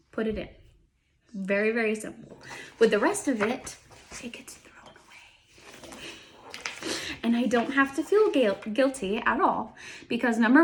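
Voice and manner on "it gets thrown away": whispered confession; a secretive whisper. Real, not acted. Confiding aside about discarding work.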